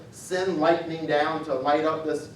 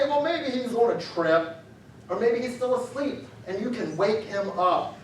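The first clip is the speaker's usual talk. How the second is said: mocking; 5 semitones higher than usual. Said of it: "He's mocking him".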